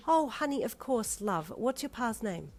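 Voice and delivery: high, sweet motherly voice; kind motherly lady. Acted. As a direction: dote on the caller like a grandma. Pleasant Missus Grump.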